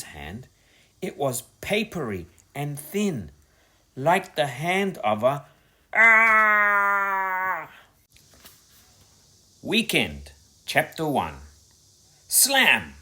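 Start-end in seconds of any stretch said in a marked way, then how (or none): 5.9-7.7 high, loud sudden scream; scream of fright. A narrator's reading. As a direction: shriek in terror. Gran touches mummy's hand.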